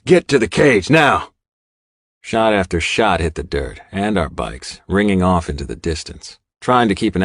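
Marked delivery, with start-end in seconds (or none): 0.0-1.3 high, loud barked command; barking order. A narrator's reading. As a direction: snap it out like a leader. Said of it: "Diamond barks".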